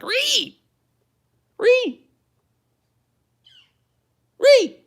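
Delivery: piercing, very high "ree" calls; imitated bird screech. Out-reeing a nearby bird, high loud screeches. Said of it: "he's like".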